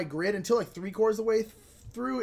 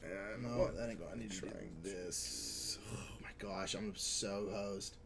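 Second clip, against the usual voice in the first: low whisper, slowing down; very soft, drawn-out mutter under the breath; whispered self-talk; 10 semitones lower, 13 dB quieter, slower than usual. Real, not acted. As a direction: whisper to yourself in dismay. Muttering over own bad game.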